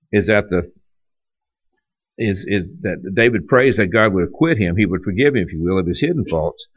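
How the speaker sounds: ordinary talk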